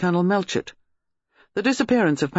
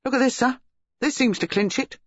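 higher-pitched, mildly urgent report; eager, raised-pitch exclamation; exclaiming subordinate; 8 semitones higher than usual. A narrator's reading. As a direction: exclaim it to a superior over a find. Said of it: "looked up and exclaimed".

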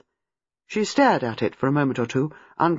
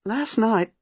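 higher, dread-tinged memory; haunted young woman, pitched up; troubled recollection; 6 semitones higher than usual. A narrator's reading. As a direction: begin the painful memory, then break off. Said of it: "shut her eyes and gulped reminiscently".

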